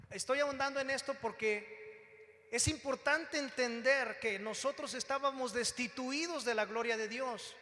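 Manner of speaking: ordinary delivery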